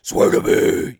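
whispered gravelly growl Batman voice. Retold or imitated. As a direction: rasp it low and dark like Batman. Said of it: "It's that darkness voice".

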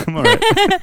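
high, loud laughing refusal. Real, not acted. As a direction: laugh it off, no thanks. Declining human meat, laughing.

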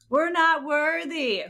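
high, slow mock worship; drawn-out high bow-down chant; Wayne's World catchphrase. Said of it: "Wayne's World".

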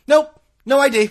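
loud, high gleeful exclamation; triumphant. Real, not acted. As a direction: crow it out in glee. Triumphant punchline about not carded.

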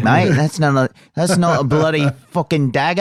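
hammed-up Aussie accent; Australian accent. Shown as a demonstration. Asked by the host to show off an Australian accent. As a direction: try out a comic Australian accent. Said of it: "do you have an Australian accent".

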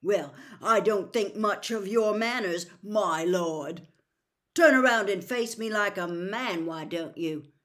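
loud, scornful challenge; rough, defiant. A narrator's reading. Frank mocking the self-styled lord, rough and defiant. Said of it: "said Frank roughly".

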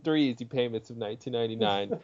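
fast, soft infomercial pitch; home shopping host. Pretend. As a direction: rattle off the price like a TV ad. Mock ad pitch.

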